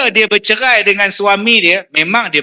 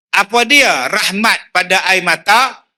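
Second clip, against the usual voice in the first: ordinary delivery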